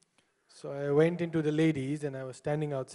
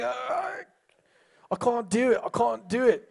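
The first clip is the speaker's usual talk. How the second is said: higher, straining protest; put-on helpless straining; mock struggle; 5 semitones higher, slower than usual. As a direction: act out a comic failed effort. Acts out man failing to head-shake.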